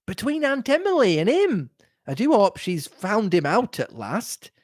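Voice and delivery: high, swooping, gossipy excitement; eager greeting. A narrator's reading. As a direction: burst in eagerly with fresh gossip. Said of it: "greeted me eagerly with".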